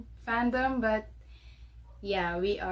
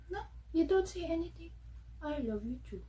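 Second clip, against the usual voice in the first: fond pet talk; 7 semitones higher, 7 dB quieter, faster than usual. Real, not acted. Teasing talk to her dog.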